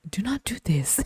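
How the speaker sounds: hushed, voiceless warning; mock dramatic whisper; imitating a whisper. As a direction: breathe it like a grave secret. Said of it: "who talks like that?".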